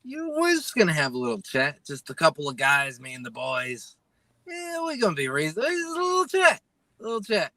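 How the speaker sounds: mimicking the streamer. Host mimicking him priming everyone for a phone call, mock reassuring. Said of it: "And he's saying".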